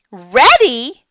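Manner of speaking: very high, loud yell; squealed question; excited yell. A narrator's reading. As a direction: yell it back, eager. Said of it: "I yelled".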